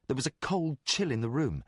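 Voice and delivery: usual speaking voice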